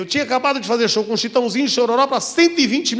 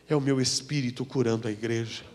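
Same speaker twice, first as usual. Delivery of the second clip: a hint of a low, soft divine voice; voice of the Lord; 10 semitones lower, 9 dB quieter than usual. The Lord explaining the healing, softly and lower. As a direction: hush it like a voice from heaven. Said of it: "E o Senhor dizia".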